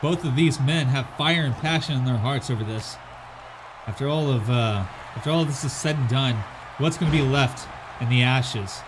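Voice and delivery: play-by-play wrestling call; wrestling commentator. Acted. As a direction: call the match like a ring announcer.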